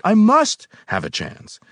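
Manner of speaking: high, loud urgent plea; worked-up, insistent outburst; increasingly excited. A narrator's reading. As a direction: insist desperately, voice jumping up. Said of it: "growing more and more excited".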